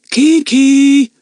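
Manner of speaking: loud, high call into the dark; yelling. A narrator's reading. Lucy Ann yelling for the parrot, loud and high. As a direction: shout the name down the tunnel. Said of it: "she yelled".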